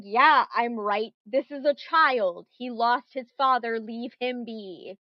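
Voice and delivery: slow, loud and firm; emphatic scolding. Acted. GM voices Annabelle defending a child, emphatic scolding. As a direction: lay down the law, slowly. GM acts Annabelle arguing back.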